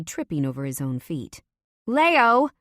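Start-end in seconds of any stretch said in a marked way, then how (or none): 1.9-2.5 loud, high yell of his name. A narrator's reading. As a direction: shout Leo across the room. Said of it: "I yell".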